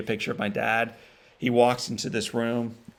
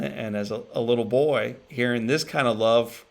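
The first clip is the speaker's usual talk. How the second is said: ordinary talk, nothing marked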